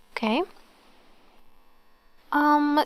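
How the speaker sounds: usual speaking voice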